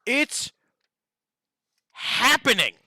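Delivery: host hyping the Halo face-off to viewers, excited and loud. Real, not acted. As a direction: pump up the big showdown, loud and high. Hyping upcoming Halo challenge.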